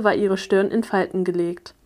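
ordinary delivery, nothing marked

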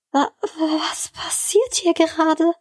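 high, slow, stammered disbelief; disbelieving stammer. A narrator's reading. Woman confronting her boss in shock, disbelieving stammer. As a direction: stumble on the first word, stunned and high. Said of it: "stammelte sie ungläubig".

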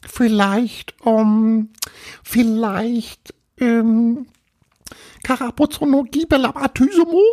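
high ghost voice, halting then rattling off; little ghost inventing. Acted. Little ghost makes up a name for Paul, high halting voice.

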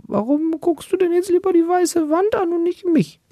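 put-on very high, wounded whine at the baby; high whiny parent voice asking why the wall wins; hurt high plea. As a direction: plead like a jilted parent. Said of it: "Du denkst dir so".

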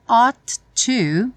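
ordinary delivery

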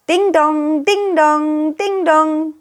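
doorbell imitation.

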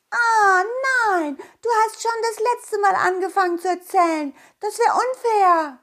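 put-on high whiny kid protest; a child objecting loudly.

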